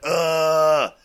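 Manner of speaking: loud, high, drawn-out "ugh"; frustrated yell, held long; amped-up roar. Retold or imitated. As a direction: let out a long retold battle cry. Acting out his glass-smashing rage.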